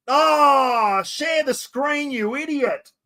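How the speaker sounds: high-pitched, drawn-out cry of exasperation; exasperated outburst at yourself; exasperated self-scolding. Real, not acted. Scolds self for screen mistake.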